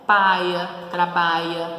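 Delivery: Paia and abeia with I instead of LH, loud; sertanejo accent. Retold or imitated. Teacher voicing the sertanejo of Patativa, loud. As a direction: swap lh for i like a sertanejo. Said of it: "o L H não apareceria".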